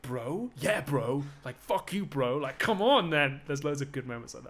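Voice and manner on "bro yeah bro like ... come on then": higher, louder jokey bro taunt; hammed-up lad squaring up; mock bro paraphrase. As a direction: reader joking about Achilles and Hector, mock bro taunt. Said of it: "lines in this that are like".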